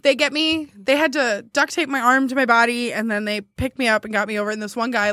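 normal talking voice

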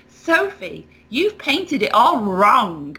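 "wrong" punched hard like uppercase; mocking kid. Kid taunts Sophie's painting, stressing wrong. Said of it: "and wrong's in, like, uppercase".

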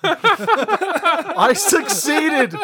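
laughing. Real, not acted. Laughing triumph.

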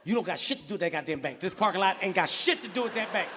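ordinary talk, nothing marked

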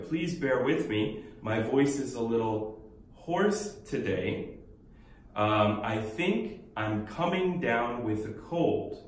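very slow, word by word; walk the learners through it slowly; unhurried teaching read; slow model dialogue. Shown as a demonstration. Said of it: "원어민 많이 쓰는 표현".

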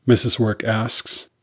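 ordinary delivery

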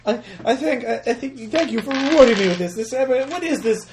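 very high, fussy put-on voice; delighted, polite character. Tiny Tim on the ride thanking sir, high delighted voice. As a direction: gush in a high, formal little voice. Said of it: "They're like".

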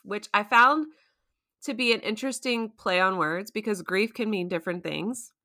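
ordinary talk, nothing marked

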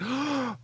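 sudden high shocked intake of breath; sharp gasp. Retold or imitated. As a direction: gasp as if the worst just happened. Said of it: "I'm like".

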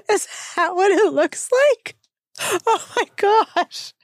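can't believe how big it is, laughing; incredulous laughing. Real, not acted. Host marvelling at the huge corsage, high laughing disbelief.

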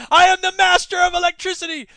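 hammed-up proclamation of power; mock triumphant shout. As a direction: boast like a cartoon overlord. Mock boast about gadgets.

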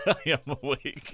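laughing through the words. Real, not acted. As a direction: chuckle while you say it. Laughs while answering.

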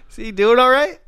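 squeaky high concerned voice; high-pitched roommate voice. Pretend. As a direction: pitch way up, fussing. Continuing the roommate bit.

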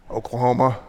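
mildly grudging reply; understated reluctance; reluctant answer. Retold or imitated. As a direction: give it up reluctantly. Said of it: "the professor reluctantly answered".

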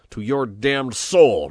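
slow, high, very loud curse; furious roar. A narrator's reading. Chief cursing his henchman, furious roar. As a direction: roar it out, stretching each word. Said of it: "roared Pepone furiously".

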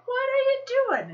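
high, mock-panicked question; alarmed husband. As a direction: squeal it like an exaggeratedly worried husband.

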